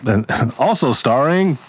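put-on old Hollywood announcer. Announcer intro voice.

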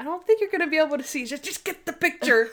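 excited retold urging; eager urging. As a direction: rush it out, giddy and insistent.

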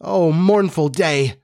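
drawn-out theatrical mourning; mournful lament. Acted. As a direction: lament it slowly, voice lifted. Lament over the dead lady.

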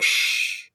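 voiceless hushing hiss; shushing. Retold or imitated. Host shushing Paul whenever he opens his mouth, voiceless shush. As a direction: shush him with a long "shh". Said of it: "I'm just like".

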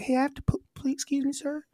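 high, softening little plea; meek pleading act. Acted. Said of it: "maybe if I was more like".

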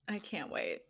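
soft, voiced "I can't wait."; quiet. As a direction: say "I can't wait." softly but with voice. Repeats it aloud softly.

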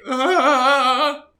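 sudden high frightened yelp; player's startled high yelp. Acted. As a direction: yelp like you've been caught.

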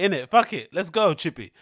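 ordinary talk

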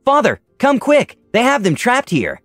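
high, slow-stretched call for father; urgent yell. A narrator's reading. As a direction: daughter urgently summoning her father. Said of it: "she yelled in Draconic".

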